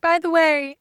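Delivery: a hint of a prim client breaking news; mock client voice. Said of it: "my client's like".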